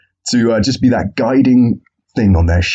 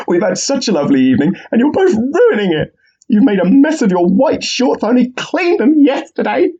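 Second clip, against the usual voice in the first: much higher, fussing like a house-proud parent; fussy mock referee. Referee scolding two bleeding boxers, high fussy nag. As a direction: scold them as if they ruined the evening. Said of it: "So the referee says".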